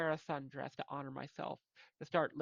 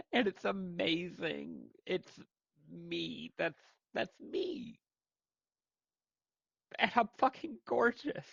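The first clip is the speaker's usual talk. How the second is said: rising much higher and louder into gorgeous; joyful self-affirmation; 5 semitones higher, 4 dB louder, slower than usual. Real, not acted. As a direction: build from wonder to triumphant delight. Self-affirming, joyous climax.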